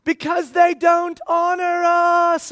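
high, slow, knowing voice; wife's retort. Retold or imitated. Wife calling down from upstairs, high and slow. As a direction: call down the answer from upstairs. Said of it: "my wife's upstairs".

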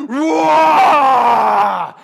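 huge, loud roar of a mother bear; mama bear roar. Retold or imitated. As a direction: bellow it out like a giant mother bear. Mama bear roaring in story.

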